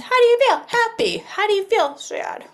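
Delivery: high, bright questions to the class; teacher greeting the class each morning, high and lilting; sing-song morning check-in. Shown as a demonstration. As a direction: ask the little ones in a lilting teacher voice. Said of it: "I'm asking my friends".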